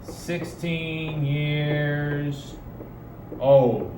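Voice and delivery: put-on "I am sixteen years old" stretched very slowly, word by word; very slow drawn-out. Shown as a demonstration. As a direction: draw every word out slowly for the learner. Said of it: "we would tell somebody".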